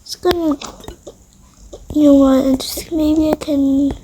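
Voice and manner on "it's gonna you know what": plain reading voice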